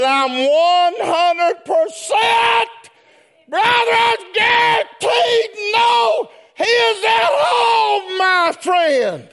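high, shouted gospel preaching; slow-building, high-pitched revival shout; full-force preaching. Real, not acted. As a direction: belt it out to the whole church.

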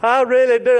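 ordinary delivery

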